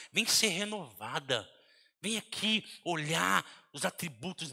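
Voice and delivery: minister softly to your own soul; tender coaxing. Retold or imitated. Preacher gently inviting his soul to God's love.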